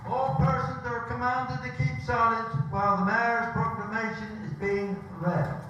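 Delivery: town crier. Acted. Crier calls for silence at ceremony.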